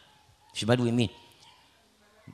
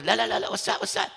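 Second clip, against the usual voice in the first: urgent warning; 9 semitones higher than usual. Retold or imitated. Woman urgently stops the crowd.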